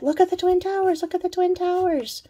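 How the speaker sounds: high, excited call to look; excited pointing out. Retold or imitated. Telling friends in the limo, high excited call. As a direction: point out the sight with delight. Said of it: "I said to the people I was with".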